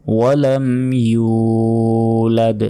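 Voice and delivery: ইউলাদ with ইউ held one second; stretched yu. Shown as a demonstration. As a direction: draw out ইউ in ইউলাদ. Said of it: "ইউ এখানে এক second টেনে পড়তে হবে".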